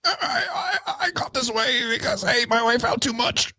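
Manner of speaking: very high, soft, stammering; stuttering RFK voice. Retold or imitated. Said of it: "I have to stutter a little more".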